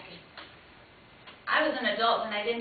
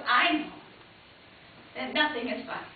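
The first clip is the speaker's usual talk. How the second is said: usual speaking voice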